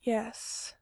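breathy hushed yes; barely voiced answer; whispered. A narrator's reading. Mikey answering Gerard with eyes closed, whispered.

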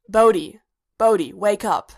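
loud, firm wake-up call; urgent calling. A narrator's reading. K two rousing Bodhi from a nightmare, urgent calling. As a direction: call out sharply to rouse a sleeper.

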